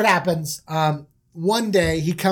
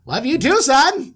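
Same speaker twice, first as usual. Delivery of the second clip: loud, raised-pitch affection; doting dad; 6 semitones higher, 5 dB louder than usual. Acted. Dad returns his son's love, loud and hearty. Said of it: "And he's like".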